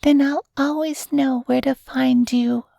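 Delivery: put-on high, sweet child's voice; little girl. A narrator's reading. Little girl answers her cloud friend, high child's voice. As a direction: pitch it up high like a small girl. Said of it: "said the smart little girl".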